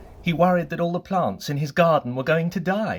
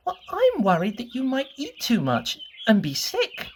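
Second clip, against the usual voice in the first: much higher, anxious fretting little voice; Mister Worry's voice; 11 semitones higher than usual. Acted. Mister Worry frets to Mister Bump in a high, anxious voice. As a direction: fret over him in a high, worried voice. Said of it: "explained Mister Worry".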